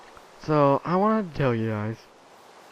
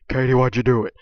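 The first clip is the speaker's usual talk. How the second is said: mock-upset scolding of the dog, louder; 9 dB louder, faster than usual. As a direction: tell the dog off for its mess, louder. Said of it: "like, upset".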